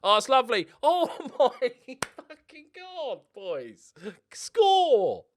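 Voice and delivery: high, loud, overjoyed yelling; ecstatic disbelief. Real, not acted. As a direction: squeal with delight, then roar for the goal. Elated at a brilliant attacking move.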